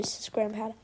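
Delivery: usual speaking voice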